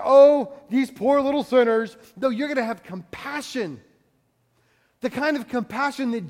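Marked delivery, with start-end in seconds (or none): 0.0-2.0 mock pity. Mocking condescending pity.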